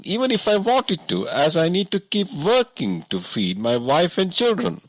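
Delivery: a simple woodsman who can't believe it; woodcutter voice. A narrator's reading. Woodcutter complains, king listens.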